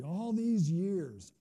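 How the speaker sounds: loud, very slow, bitter complaint; angry. Acted. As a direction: drag out each word in resentment. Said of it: "this son is really angry".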